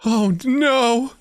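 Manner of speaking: put-on high girl voice. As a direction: squeak it like a scared teen girl. [name] in character.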